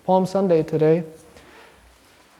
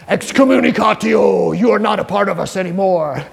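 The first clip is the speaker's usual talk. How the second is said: mock pronouncement; 4 dB louder than usual. Pharisees expelling the healed man.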